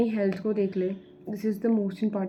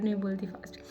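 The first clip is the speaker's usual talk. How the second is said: a quick unvoiced whisper to herself; whispered aside; 6 dB quieter than usual. Real, not acted. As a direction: drop to a whisper, correcting herself. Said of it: "मैं बहुत fast बोल रही हूँ".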